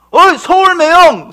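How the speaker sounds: loud, high, drawn-out holler; imitated stranger's shout; calling out. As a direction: hail someone from behind. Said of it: "뒤에서 누가 불러".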